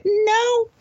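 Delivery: mock-emphatic high No; emphatic refusal. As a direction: push back hard on the No. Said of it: "You say".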